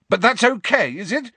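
high, loud, sarcastic challenge; angry yell. A narrator's reading. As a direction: yell it at him in indignation. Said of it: "yelled Harry".

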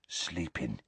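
very soft, low hushed breath; breathed softly. A narrator's reading. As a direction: breathe it out, barely voiced. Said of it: "breathed Hagrid".

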